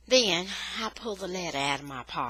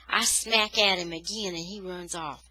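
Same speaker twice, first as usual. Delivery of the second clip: ordinary delivery, nothing marked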